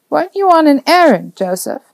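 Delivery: put-on high, lilting elderly reminder; chiding old woman. A narrator's reading. Old neighbour reminds boy of his errand, chiding.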